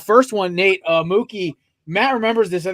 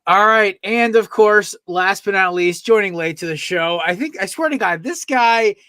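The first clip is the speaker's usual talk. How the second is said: louder, higher host intro build-up; mild announcer hype; 4 semitones higher, 7 dB louder than usual. Acted. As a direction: talk it up like a show announcer. Host hypes late guest's intro.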